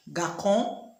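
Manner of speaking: Garçon with a hard k, as if no cedilla; wrong k sound in Garçon. Shown as a demonstration. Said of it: "what do you think that garçon will sound like".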